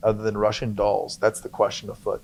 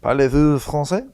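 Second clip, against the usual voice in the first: higher put-on French voice; French accent; 3 semitones higher, 4 dB louder than usual. Shown as a demonstration. Demonstrating the play accent to co-host, French accent. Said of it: "In my little French accent".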